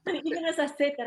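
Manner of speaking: normal talking voice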